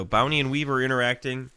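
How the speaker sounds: ordinary talk, nothing marked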